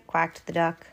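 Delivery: plain reading voice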